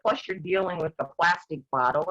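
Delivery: ordinary talk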